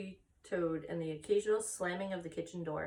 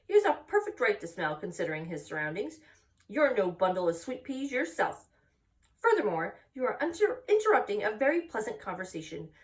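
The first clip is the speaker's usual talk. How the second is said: louder, higher indignant telling-off; sharp scolding; 3 semitones higher, 7 dB louder than usual. Acted. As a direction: put the rude lamb in its place. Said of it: "spoke sharply to the lamb".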